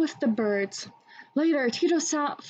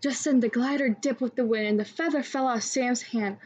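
ordinary delivery, nothing marked

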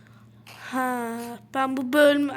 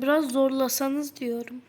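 ordinary talk

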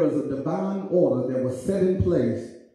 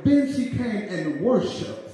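high shouted sermon climax over music; whooping climax; preaching at full force; 9 semitones higher than usual. Acted. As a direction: ring it out to the church.

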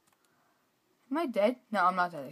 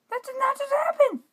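much higher shriek of disbelief; 7 dB louder than usual. Real, not acted. Losing in game.

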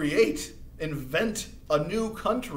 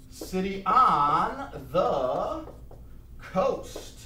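very slow, spaced out for note taking; slow dictation; 4 semitones higher, much slower than usual. Shown as a demonstration. Teacher reading out notes, slow and drawn out. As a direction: stretch each word so students can write. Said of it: "let's write this for our notes".

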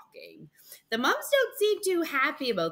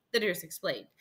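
ordinary delivery

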